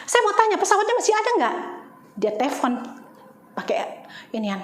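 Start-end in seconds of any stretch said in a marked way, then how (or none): 0.0-1.5 urgent question. Retold or imitated. Pressing clerk about the plane.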